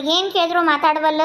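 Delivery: ordinary talk, nothing marked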